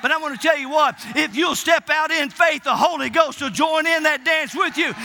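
ordinary talk, nothing marked

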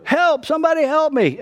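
high-pitched mock panicked call; retold cry for help. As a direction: call for help, acted without shouting. Retold cry for help while drowning.

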